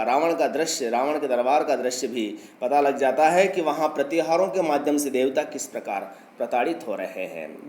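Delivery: usual speaking voice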